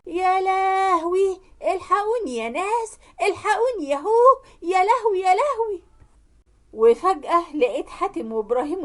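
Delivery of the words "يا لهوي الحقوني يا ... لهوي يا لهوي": hammed-up shrieking lament, pitched high; wailing for help. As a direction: cry out to the neighbours in theatrical distress. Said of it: "قعدت تصوت وتقول".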